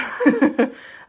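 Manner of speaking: bright burst of laughter; short laugh. Real, not acted. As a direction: laugh out loud. Laughs at the camera.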